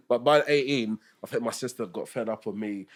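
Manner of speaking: usual speaking voice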